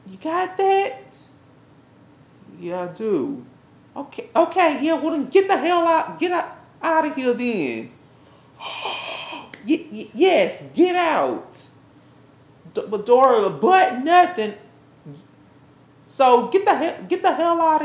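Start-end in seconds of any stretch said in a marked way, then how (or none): none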